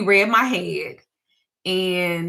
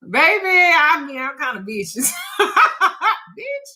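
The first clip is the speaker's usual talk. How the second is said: high, loud, laughing through the words; 9 semitones higher, 3 dB louder than usual. Real, not acted. Joking with the host about being vicious, laughing. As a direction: laugh as you admit it.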